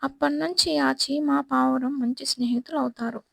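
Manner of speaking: ordinary delivery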